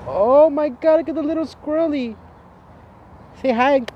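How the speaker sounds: very high, loud cooing at a cute squirrel. Real, not acted.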